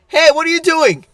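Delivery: high, loud retold call-out; playful "caught you" shout; mock challenge. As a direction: call him out loudly, as a joke. Jokingly calling out the man.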